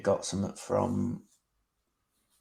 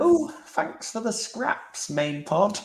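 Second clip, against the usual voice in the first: mock offended; 13 semitones higher, slower than usual. Mock offended jab at main pod.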